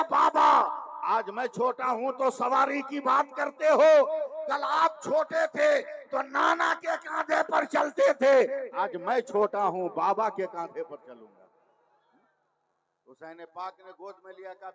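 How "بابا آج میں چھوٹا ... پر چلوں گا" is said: put-on high, loud, shouted child's plea; a loud crying appeal; tearful plea. Baby Asghar answering his father Husain, tearful loud plea. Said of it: "روتے روتے کہا".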